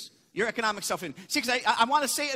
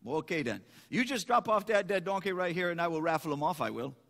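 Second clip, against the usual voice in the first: put-on Cajun accent, dropping lower; 4 semitones lower than usual. Boudreaux proposes a raffle to the farmer, Cajun accent. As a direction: play it as a joke-teller's Cajun. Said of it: "I won't get the right accent".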